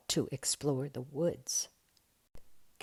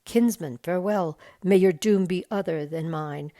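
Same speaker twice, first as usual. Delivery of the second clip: louder, ringing noble farewell; solemn elf lord; 8 dB louder than usual. A narrator's reading. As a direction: proclaim it like an elven lord. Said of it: "But Celeborn said".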